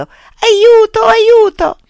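very high, loud drowning scream; desperate cry for help. A narrator's reading. Drowning boy to anyone who can save him, screaming. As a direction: scream for rescue at full voice. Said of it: "gridò, dimenandosi nell'acqua e ansimando".

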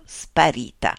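ordinary delivery

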